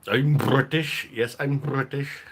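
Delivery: high, drawn-out mock British accent; put-on British accent. Mock declaration, then own voice repeats it.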